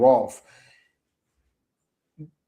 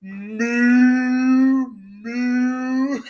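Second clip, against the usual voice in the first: high, long drawn-out mock moo; cow moo; 7 semitones higher, 3 dB louder than usual. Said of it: "That's a cow".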